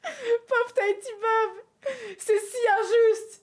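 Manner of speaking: high, loud, drawn-out wail of pity; slow, whiny fake heartbreak; mock lament. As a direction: lament the injustice, hammed-up. Mock pity for losing player.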